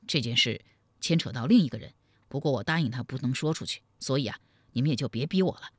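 low, quick, guarded explanation; reluctant young man. Acted. (低声快语，为难推托)

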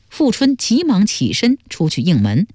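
usual speaking voice

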